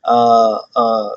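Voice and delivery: usual speaking voice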